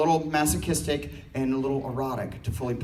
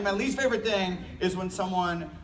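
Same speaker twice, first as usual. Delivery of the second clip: normal talking voice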